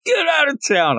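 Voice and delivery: squeaky mock son voice. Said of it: "He's like".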